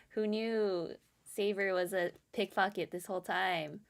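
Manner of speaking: usual speaking voice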